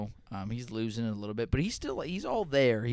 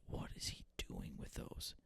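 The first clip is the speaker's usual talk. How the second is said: much softer whispered grumble; livid whisper; 17 dB quieter than usual. Retold or imitated. Grandson retells grandpa fuming over pricey tomatoes, whispered. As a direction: hiss it out like a fuming old man. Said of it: "He was livid".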